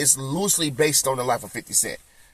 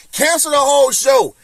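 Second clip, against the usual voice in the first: louder protest; 9 semitones higher, 5 dB louder than usual. Real, not acted. Repeats it, even higher.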